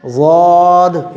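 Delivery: "ज़ाद" heavy, with a long held vowel; pronunciation demo. Shown as a demonstration. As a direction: stretch "ज़ाद" out on a level tone. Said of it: "इस तरह से". ("ज़ाद" को खींचकर मोटा पढ़ें)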